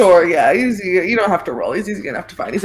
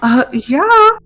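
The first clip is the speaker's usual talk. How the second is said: put-on eager little kid showing off; 2 semitones higher than usual. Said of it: "the little baby voice".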